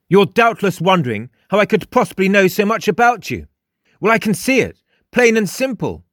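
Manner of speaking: high, emphatic self-assured character; cocky high character voice; boastful Frost. A narrator's reading. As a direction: boast grandly, pitched well up. Frost explains his insight.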